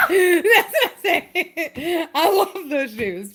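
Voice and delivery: laughing while talking slowly; giggling through it. Real, not acted. Laughing about shoes.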